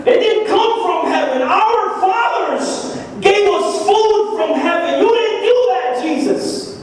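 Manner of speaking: high-pitched, insistent accusation; insulting challenge. Acted. The people disputing the miracle to Jesus, insulting challenge. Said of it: "To me, this is insulting".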